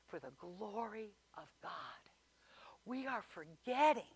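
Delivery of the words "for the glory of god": breathy whispered ending; hushed whisper of praise. Acted. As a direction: whisper it like a secret. Whispered climax.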